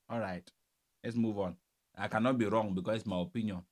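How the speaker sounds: normal talking voice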